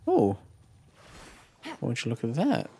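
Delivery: normal talking voice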